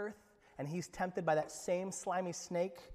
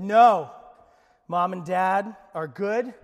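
emphatic refusal; 10 dB louder, slower than usual. Retold or imitated. Boy resisting temptation aloud.

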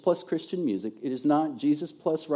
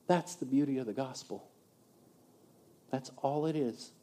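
soft, lower, reverent landing; hushed conclusion; 3 semitones lower, 9 dB quieter than usual. Real, not acted. Preacher sums up the gospel for the church, hushed. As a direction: drop to a quiet, sincere close.

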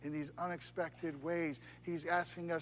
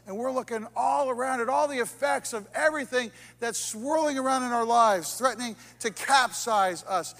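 preaching crescendo; 3 semitones higher, 7 dB louder than usual. Real, not acted. Preacher warns the church about life's storms, rising loud. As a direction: swell up like the storm itself.

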